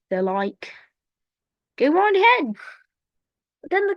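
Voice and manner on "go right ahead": high, loud cartoonish permission; acted go-ahead. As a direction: call it out as an eager crowd. Acted reply granting permission.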